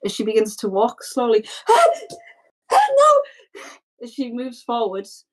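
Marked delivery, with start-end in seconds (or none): 1.5-2.1 high, sharp panicked gasp; frightened gasp. Acted. Girl feels her body crumbling, terrified high gasp. 2.7-3.2 very high, loud panicked cry; terrified little girl. Acted. Girl turning to stone in fog, terrified cry.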